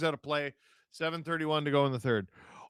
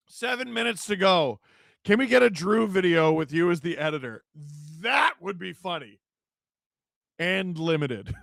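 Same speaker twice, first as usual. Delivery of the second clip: ordinary talk, nothing marked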